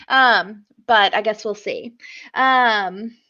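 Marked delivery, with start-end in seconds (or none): none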